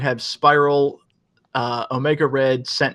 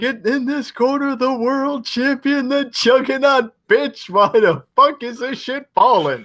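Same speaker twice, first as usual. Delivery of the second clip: high, hammed-up mock indignation; high outraged cartoon yell; parody quote; 9 semitones higher than usual. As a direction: stretch out the name, then rant. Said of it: "my favorite quote is when".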